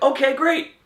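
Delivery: high chirpy okay; mock eager agreement. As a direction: play it as a keen yes-man. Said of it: "So they were like".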